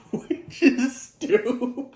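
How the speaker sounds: words breaking up into high laughter; slow, high and helpless with laughter; laughing. Real, not acted. Reviewer cracking up at a silly plot detail, laughing.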